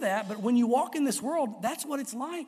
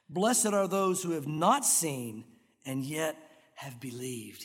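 reverent quote; 7 semitones lower, slower than usual. Acted. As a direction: let the blessing sink into a hush. Quoting Jesus reverently.

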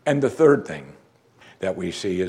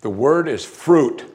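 slow, louder, stress on singular "fruit"; slow and deliberate; 4 semitones higher, 5 dB louder, slower than usual. Shown as a demonstration. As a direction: spell it out slowly, landing hard on "fruit". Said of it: "Let me say it slow".

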